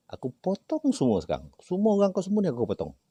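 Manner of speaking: high, fast, hammed-up anger; retold angry threat. Healer threatening the spirit's people, fast angry outburst. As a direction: bark out the threat to cut them all.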